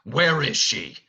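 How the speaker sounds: a touch of a beastly demand; lightly voiced Beast. Drama director reads Beast's line to the cast, demanding. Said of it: "then Beast says".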